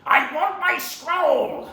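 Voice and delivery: slow, loud snarl building up; angry villain. Acted. Villain rejects apology and demands scroll, angry. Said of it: "he goes".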